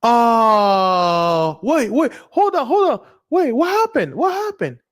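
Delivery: high, shocked cries of wait and hold on; panicked disbelief. Pretend. Gambler shocked as the machine stops paying, high panic. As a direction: yelp in alarm as the win vanishes. Losing streak suddenly hits.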